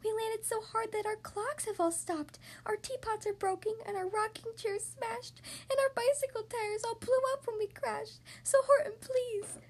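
high, small distressed voice; tiny mayor pleading for help; high-pitched little voice, plaintive. A narrator's reading. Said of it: "pleaded the voice of the mayor's".

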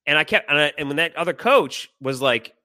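ordinary talk

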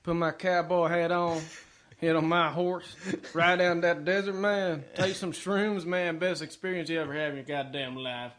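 slow Texan cowboy drawl; Texas accent. Shown as a demonstration. Cowboy musing on riding the desert, slow Texas drawl. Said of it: "kind of the Texas accent".

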